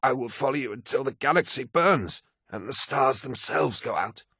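high, impassioned pledge; sworn oath. A narrator's reading. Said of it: "swore Malakhurst".

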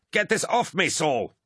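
high, urgent yell for help; yelled plea. A narrator's reading. Trapped warrior to his friend Saul, high urgent yell. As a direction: yell up at a comrade while struggling. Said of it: "he yelled".

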